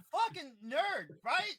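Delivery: loud mock outrage. Real, not acted. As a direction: yell it out, playfully indignant.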